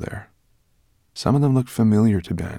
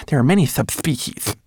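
high little lecturing voice; high, scholarly creature voice; Twigleg, knowing little mannequin; 7 semitones higher, 3 dB louder than usual. A narrator's reading. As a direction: inform them precisely in a small voice.

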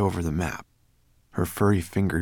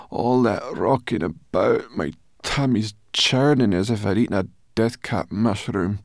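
queasy, higher-pitched complaint; groaning; 5 semitones higher, 4 dB louder than usual. A narrator's reading. Sorrel seasick after the rocking boat, groaning complaint. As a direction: moan on about a churning stomach. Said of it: "she groaned".

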